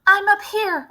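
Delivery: high, loud call down from the tree; shouted back. Acted. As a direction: call out from far up. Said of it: "I shouted back".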